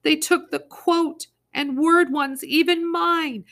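high, loud, slow lament; wailing. Acted. Early wailing that they even took hers. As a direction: drag out the loss tearfully. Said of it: "Early wailed".

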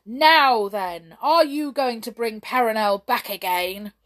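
loud, stern royal demand; storming. A narrator's reading. King challenging the brownies, storming. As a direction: press them hard at full volume. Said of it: "stormed the king".

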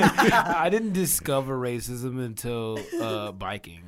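slow, loud, laughing mimicry; mocking quote. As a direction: tease him by quoting through laughter. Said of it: "You said".

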